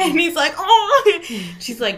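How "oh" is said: very high, loud cry through laughter; hammed-up yelp from being hit on the head; mock yelp of pain.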